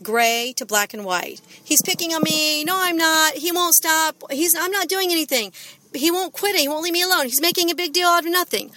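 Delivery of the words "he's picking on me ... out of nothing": put-on high-pitched kids' squabble; bickering siblings. Offender and offended child accusing each other, high-pitched bickering. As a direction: argue back and forth like protesting children.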